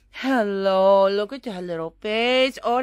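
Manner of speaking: ordinary talk, nothing marked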